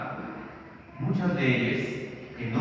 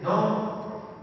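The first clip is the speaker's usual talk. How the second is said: ordinary talk, nothing marked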